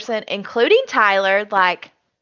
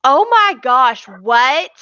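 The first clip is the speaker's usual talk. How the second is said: a louder, stunned gasp of disbelief; imitating shocked Cody; 2 semitones higher, 5 dB louder, much slower than usual. Cody hearing Danny will use the veto, mock stunned. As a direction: play his jaw-drop moment, slowed down. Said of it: "he's just like".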